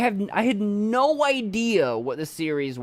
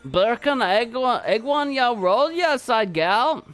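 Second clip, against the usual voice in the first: clumsy, higher, louder Jamaican accent attempt; 8 semitones higher, 5 dB louder, slower than usual. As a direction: try a hammed-up Jamaican accent, a bit slow. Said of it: "I don't know how to do a Jamaican accent".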